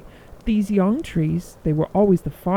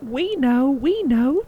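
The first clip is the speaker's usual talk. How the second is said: higher, bouncy sparrow chirp; 7 semitones higher than usual. A narrator's reading. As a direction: chirp it like eager little birds. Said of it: "chirped the sparrows".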